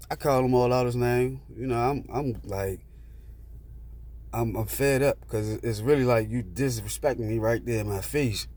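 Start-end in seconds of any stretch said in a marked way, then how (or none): none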